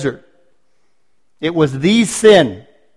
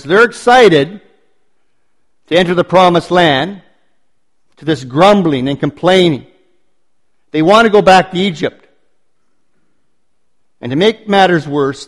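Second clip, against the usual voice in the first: ordinary talk, nothing marked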